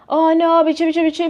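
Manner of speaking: high, loud whiny plea; mock pleading. Imitating the client pleading with her, whiny high. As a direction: mimic the client's desperate wheedling.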